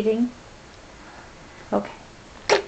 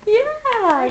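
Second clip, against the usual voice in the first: high bright yeah, much higher; excited praise; 12 semitones higher, 3 dB louder than usual. Real, not acted. Mother cheering her toddler's answer, high and excited. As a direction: cheer the little one on, way up high.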